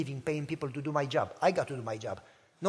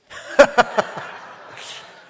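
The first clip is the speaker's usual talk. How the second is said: a loud breathy laugh, real amusement; burst of laughter; 5 semitones higher, 11 dB louder than usual. Real, not acted. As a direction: laugh out at the punchline. Laughs at dad's joke.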